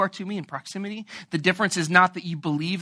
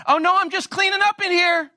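mock breezy denial; 11 semitones higher, 5 dB louder than usual. Annoyed spouse feigning calm.

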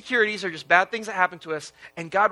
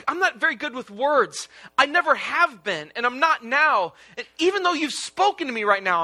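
higher-pitched, insistent plea; acted desperate begging, pitched up; begging and pleading; 5 semitones higher than usual. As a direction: plead your inadequacy, as Moses to God. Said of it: "He's begging and pleading with God".

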